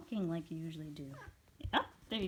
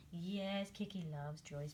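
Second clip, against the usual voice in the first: usual speaking voice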